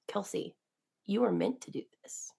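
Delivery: soft, quiet reassurance; gentle encouragement. Retold or imitated. Friend comforting her through tears, softly reassuring.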